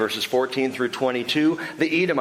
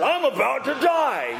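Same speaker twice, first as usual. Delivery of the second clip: dramatic Esau; 10 semitones higher than usual. Retold or imitated. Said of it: "he says that".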